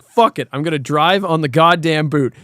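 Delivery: ordinary talk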